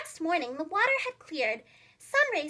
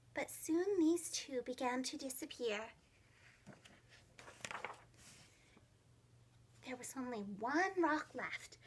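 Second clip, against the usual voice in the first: quiet; 4 semitones lower, 10 dB quieter than usual. A narrator's reading. Tense flood narration.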